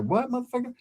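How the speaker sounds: imitating her high, defiant comeback, pitched well up; defiant retort. Girlfriend talking back to the bike cop, high, defiant mock retort. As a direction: throw it back at him, high and cocky. She defies the cop, retold.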